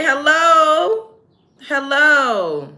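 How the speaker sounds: loud, high, drawn-out hellos; calling out. Real, not acted. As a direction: holler for their attention, high and long. Calling out to the chat for donations.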